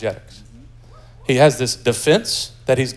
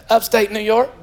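put-on Southern accent, pitched up, laughing; 7 semitones higher than usual. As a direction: say it straight-faced in a Southern accent. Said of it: "unforgettable Southern accent".

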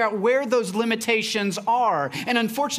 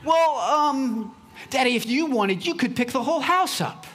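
put-on little girl voice; 5 semitones higher than usual. Daughter upping the ante.

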